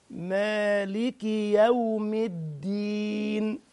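slow, drawn-out tajweed chant; Quran recitation. Shown as a demonstration. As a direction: recite it slowly, letting each vowel ring.

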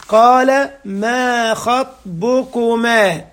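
each word drawn out, weighed carefully; slow emphatic recitation. Shown as a demonstration. Preacher stressing a prophet's brief words, slow. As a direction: recite it very slowly to show how few words he used. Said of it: "ما قالش حضرتك اسمك ايه؟".

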